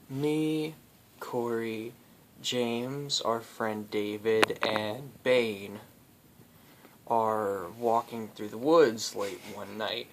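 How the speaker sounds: normal talking voice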